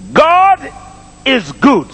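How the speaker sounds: GOD held out high, then a pause before "is good"; slow, high-pitched proclamation in two parts. Shown as a demonstration. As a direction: declare it slowly so the crowd can repeat it. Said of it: "make that a little slower".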